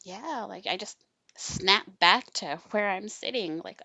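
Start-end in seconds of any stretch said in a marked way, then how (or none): none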